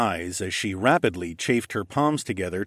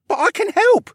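put-on very high, loud child's voice; protesting son; 7 dB louder than usual. A narrator's reading. Son protesting at being left behind, high-pitched protest. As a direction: plead like an eager boy, high and loud. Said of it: "my son protested".

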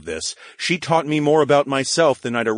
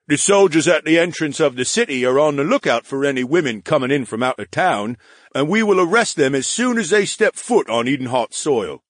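slightly higher, louder soldier report; dutiful guard; 5 semitones higher, 4 dB louder than usual. Acted. Guard character voice.

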